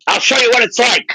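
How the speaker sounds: mock threat, pitched high. Bandleader threatening his band on tape, imitated threat. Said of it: "he's threatening his band".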